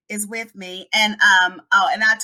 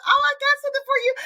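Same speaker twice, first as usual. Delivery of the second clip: high, quick chirp; gushing old lady; much faster than usual. Retold or imitated. Old lady offering a gift as guests leave, gushing. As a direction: pipe it up fast and fussing. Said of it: "She said".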